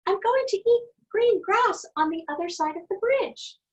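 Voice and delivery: squeaky high little billy goat; tiny goat voice. A narrator's reading. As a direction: pitch way up like the smallest goat. Littlest goat keeps talking.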